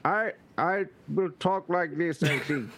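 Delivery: halting joke attempt at a Middle English accent; mock Middle English accent. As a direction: put on a badly faked Middle English accent. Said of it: "that's how that accent sounded way back then".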